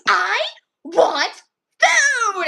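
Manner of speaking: imitated scream, high and loud; screaming hungry girl. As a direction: yell it word by word like a demanding child. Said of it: "she was, like, screaming".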